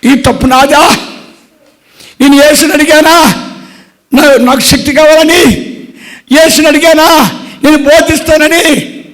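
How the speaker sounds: impassioned sermon questions; fervent preaching. Acted. Preacher's rhetorical outcry.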